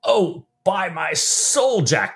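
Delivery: high, drawn-out exclamation; theatrical dismayed outburst; crying out. Acted. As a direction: cry it out slowly as a character in a novel. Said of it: "cried Stephen".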